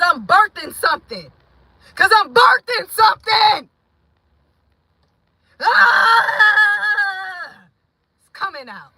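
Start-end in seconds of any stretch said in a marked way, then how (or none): 1.9-3.7 "birthing" shouted high and loud. Real, not acted. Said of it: "I'm screaming and I'm yelling". 5.6-7.7 high, loud cry of release; labour scream. Real, not acted. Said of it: "I'm screaming and I'm yelling".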